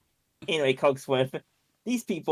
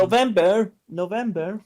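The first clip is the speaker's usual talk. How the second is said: higher-pitched questioning call of a name; calling for attention; 6 semitones higher than usual. Acted. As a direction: get November's attention, voice lifting each time. Nico calls robot's name questioningly.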